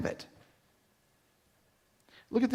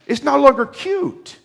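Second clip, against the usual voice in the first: higher, louder and slow, stretching longer; mock incredulous; 9 semitones higher, 7 dB louder, slower than usual. Real, not acted. Preacher mocking adults acting childish, slow incredulous punchline. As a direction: land it with comic disbelief.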